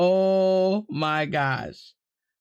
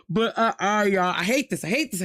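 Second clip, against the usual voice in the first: ordinary talk, nothing marked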